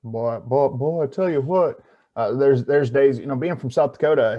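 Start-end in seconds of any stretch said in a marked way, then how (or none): 0.0-3.2 Southern accent. Shown as a demonstration. South Dakota man showing his Southern accent. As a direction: lay on a Southern accent for fun. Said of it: "I sound like I'm from the damn South".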